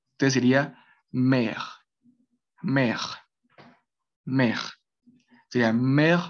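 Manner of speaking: mer with a gargled French R at the end; French syllable. Shown as a demonstration. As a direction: say mer, rolling the R in the throat. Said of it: "ese es el sonido de la R".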